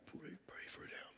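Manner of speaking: embarrassed whisper of pray; barely breathed, ashamed. Retold or imitated.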